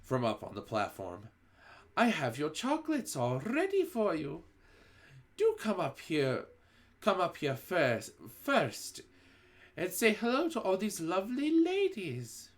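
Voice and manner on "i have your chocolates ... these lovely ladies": high, sugary-sweet witch voice; syrupy witch. A narrator's reading. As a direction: coax like a witch dripping with syrup. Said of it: "soft and gentle and absolutely dripping with syrup".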